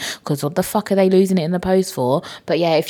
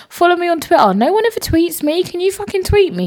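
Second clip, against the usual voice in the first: high-pitched comic pleading rant; mock outrage; 12 semitones higher, 3 dB louder than usual. Real, not acted. Host begging listeners to tweet, mock outrage. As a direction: whine it high at your listeners.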